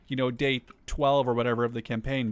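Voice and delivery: usual speaking voice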